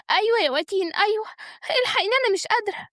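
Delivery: high-pitched, urgent cry for help; distressed plea. Acted. Sick woman begs her friend for rescue, distressed. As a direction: plead as if in pain. Feigns illness, calls for help.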